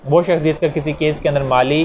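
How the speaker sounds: ordinary delivery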